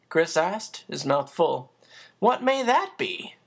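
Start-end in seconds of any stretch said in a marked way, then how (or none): 2.2-3.3 higher, puzzled; puzzled housekeeper. Acted. Becky asks Chris about the icebox, puzzled. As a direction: ask like you've never heard the word.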